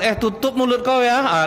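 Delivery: high, sharp shut-up retort; mock angry retort. Spouse telling the other to shut up, mock angry. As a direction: bite back at the spouse.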